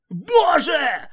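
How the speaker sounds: loud high cry of terror; desperate scream. Acted. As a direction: scream as the trap closes. Trap snaps shut.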